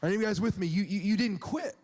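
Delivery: ordinary talk, nothing marked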